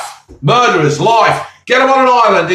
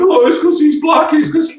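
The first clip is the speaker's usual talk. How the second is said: mocking Diane Abbott; 8 semitones higher than usual. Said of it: "Diane Abbott going".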